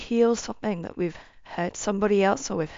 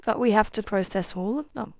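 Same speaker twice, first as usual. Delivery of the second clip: ordinary talk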